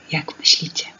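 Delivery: ordinary delivery, nothing marked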